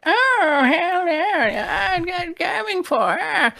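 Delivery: very high, loud, awful witch voice; high-pitched witch voice. Retold or imitated. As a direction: squeak it out like a bad cartoon witch. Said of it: "really awful, like, high-pitched witch voice".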